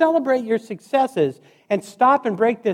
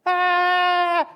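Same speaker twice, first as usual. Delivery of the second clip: high pained scream of agony; retold scream; 9 semitones higher than usual. Patient in hospital, screaming in pain, high. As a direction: cry out from unbearable pain. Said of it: "all I can do is scream".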